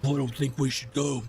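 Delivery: quick put-on objecting voice; lightly voiced reluctant crewmate; Zar. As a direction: grumble back at the bosun. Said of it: "and Zar goes".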